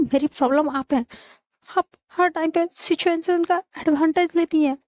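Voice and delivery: mildly heated quarrel voice; indignant accusation. Acted. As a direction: throw the blame back at her. Acted quarrel, accusing the other woman.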